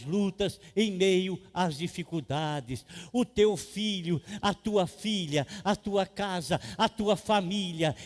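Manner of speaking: loud, slowed pulpit exhortation, hammering each phrase; fervent preaching. Acted. Pastor urging a struggling brother not to give up, fervent loud preaching. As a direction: preach it hard, begging them not to quit.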